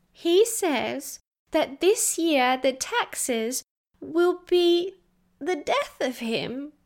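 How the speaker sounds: put-on slow, faltering child near tears; voice breaking. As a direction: let the voice break on the last words. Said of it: "Her voice broke as she said".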